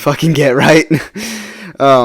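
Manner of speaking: real laugh breaking into the words. Real, not acted. Joking to viewers about taking what you can get, laughing. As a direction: crack up at your own admission.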